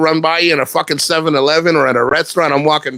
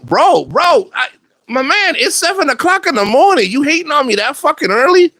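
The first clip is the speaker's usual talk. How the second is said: exasperated reply; 13 semitones higher than usual. Retold or imitated. Retold reply to early insults.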